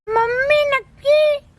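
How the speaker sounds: put-on high, drawn-out baby-talk plea; whining toddler. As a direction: whine slowly to mother like a tiny child. Toddler begs mother for snack.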